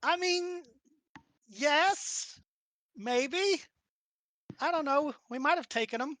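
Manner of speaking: high-pitched character voice, halting; hesitant Yuki. Acted. Yuki half-agreeing with Reggie about the fight, high hesitant voice.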